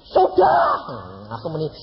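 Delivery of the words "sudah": high, loud smug shout; a cocky know-it-all shouting back; mock-smug high yelp; smug reply. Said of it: "Terus dia bilang gini".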